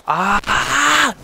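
sudden high, loud scream; startled yell bursting out mid-game. Real, not acted. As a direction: let out a real high scream. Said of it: "Io sto urlando".

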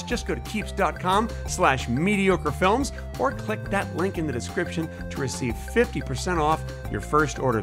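normal talking voice

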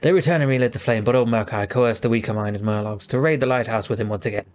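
ordinary delivery

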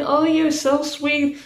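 teasing, sweet gushing; mock gushing. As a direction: gush over him teasingly.

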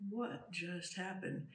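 slow, hushed bewildered question; dazed whisper of disbelief; stunned hush. Retold or imitated. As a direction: ask it softly in awe. Stunned after spirit encounter.

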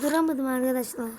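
ordinary talk

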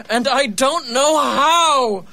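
normal talking voice

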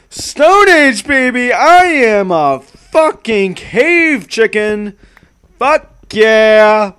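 loud high cheering, stretched out; hyped shout. Real, not acted. Streamer celebrating his Stone Age house, hyped shouting. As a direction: cheer it like a caveman.

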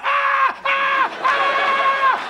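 high mock screams of a hooked fish; hammed-up fish yelling; fish screaming. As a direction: shriek way up high like a fish on a hook. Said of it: "They'd be going".

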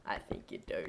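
whispering. Real, not acted. Whispered laugh after dog voice.

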